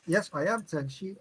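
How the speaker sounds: ordinary talk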